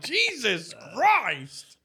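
very slow, stretched mock disbelief; drawn-out exasperation. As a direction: drag it out like you can't believe it. Reacting to a gross meme.

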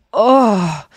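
acted-out exhausted "oh"; spent groan of relief. Comedian drained after meeting fans, re-enacted spent groan. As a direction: let out a big drained exhale. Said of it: "afterwards you're like".

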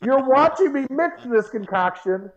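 rapid, high-pitched mock outrage; exasperated protest. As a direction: retell your outraged comeback at your teammate. Retold outrage at teammate.

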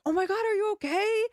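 put-on high worried gasp of a boyfriend; imitating a fretful boyfriend; mock concerned partner. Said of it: "they are like".

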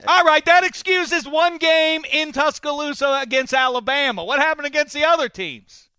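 high, loud, exasperated demand; mock outraged shout. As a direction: blow up in hammed-up disbelief. Rejects guest's excuse, shouting again.

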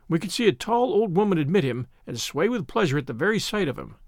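plain reading voice

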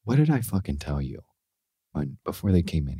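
low, hushed reprimand; quiet, menacing scolding. Retold or imitated. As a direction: scold him under your breath. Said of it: "Sam Rockwell's like".

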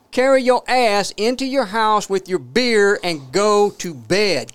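slow, high shout, hitting each word; acted furious yelling. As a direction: holler it right into his ear. Re-enacts yelling at man held up.

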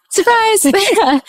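high, bright sing-song reveal; playful surprise. Real, not acted. Guest revealing herself as the mystery flight attendant, playfully. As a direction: pop it out like a party surprise.

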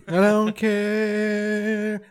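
very slow, stretched I don't care. Retold or imitated. Friend mocking a blunt reply, slow stretched rock drawl. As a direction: drag every word out lazily. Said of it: "That's a very Weezer thing to say".

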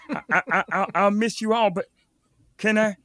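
normal talking voice

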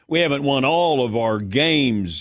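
put-on slow, drawn-out Southern accent; Jerry Jones impression. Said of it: "my Jerry Jones one".

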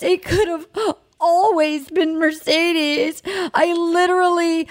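imitating a blubbering guy; hammed-up crying quote; mock sobbing. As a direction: whine it out like you're crying. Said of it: "he's sobbing".

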